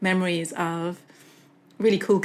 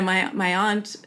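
ordinary talk, nothing marked

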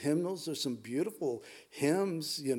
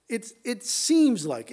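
seems drawn out slowly, higher and louder; stress on seems; 5 semitones higher, 5 dB louder, slower than usual. Shown as a demonstration. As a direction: lean hard and slow on the word seems. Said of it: "I'm emphasizing the word seems".

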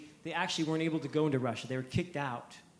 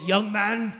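put-on loud, high German-accented preacher; 5 semitones higher, 9 dB louder than usual. German preacher addressing a teenager, loud imitated accent. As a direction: imitate a booming German evangelist. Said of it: "he's this German guy".

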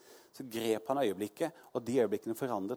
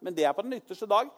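Martha answering; 7 semitones higher, 3 dB louder than usual. Retold or imitated. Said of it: "sier Martha, hun hadde tro".

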